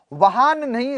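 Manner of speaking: usual speaking voice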